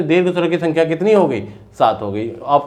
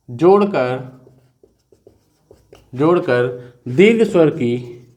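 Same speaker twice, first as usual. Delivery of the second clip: slow, spaced-out dictation with long pauses; 2 semitones lower, slower than usual. Shown as a demonstration. Teacher dictating slowly so students can write. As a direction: dictate word by word for notes. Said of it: "note लिख लीजिए यहाँ पे आप लोग".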